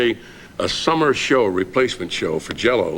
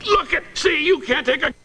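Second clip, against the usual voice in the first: Johnny near tears. Retold or imitated. Sidekick retells boss praising him, high teary choked voice. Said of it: "He's in near tears".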